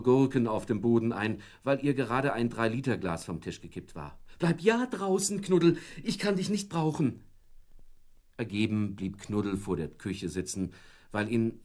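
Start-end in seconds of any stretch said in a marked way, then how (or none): 4.4-7.2 high, louder shooing of the dog; harried wife shooing the dog; stressed shooing. Acted. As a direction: shoo him out of the kitchen, high-pitched. Busy wife shoos the dog.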